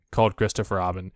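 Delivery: usual speaking voice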